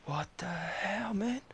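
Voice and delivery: high, slow, hushed what the hell; stunned disbelief. Retold or imitated. Vlogger stunned when most kids raise hands, high and hushed. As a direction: mutter it in disbelief. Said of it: "I was like".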